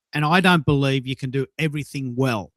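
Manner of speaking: ordinary delivery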